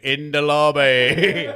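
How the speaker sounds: loud, slow, drawn-out British parroting; mock British accent. Phrase stuck in his brain after overhearing it, mock British accent. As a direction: blurt it out stretched in a silly British accent. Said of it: "doing it in a British accent".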